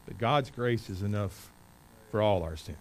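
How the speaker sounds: ordinary talk, nothing marked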